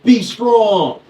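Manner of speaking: slow, loud prison-style pep talk; mock stern warning. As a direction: hammer it out like a mock drill instructor. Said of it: "you come in and they're like".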